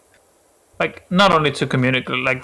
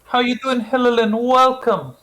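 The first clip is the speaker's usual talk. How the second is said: high, bright greeting voice; high cartoon voice; 12 semitones higher, 4 dB louder than usual. Voicing character greeting.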